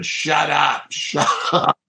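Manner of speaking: high, half-voiced yell; mock scream. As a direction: imitate someone screaming, without full volume. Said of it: "he just keeps screaming".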